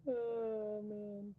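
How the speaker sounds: ordinary talk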